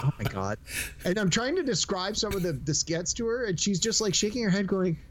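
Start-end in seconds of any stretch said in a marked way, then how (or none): 0.0-0.6 low-pitched, imitated weary dismay; eye-rolling exasperation. Wife reacting to husband watching Jackass, imitated exasperation. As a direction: drop it low with an audible eye roll. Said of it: "could literally hear her eyes roll".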